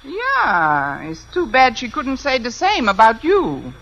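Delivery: mock-German dig; put-on German accent. Fake applicant needling the principal, put-on German accent. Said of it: "If I can do a decent German accent".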